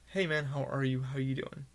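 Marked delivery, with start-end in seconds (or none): none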